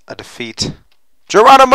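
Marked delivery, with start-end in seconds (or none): 1.3-1.7 Geronimo shout. Real, not acted. Jumping off a cliff.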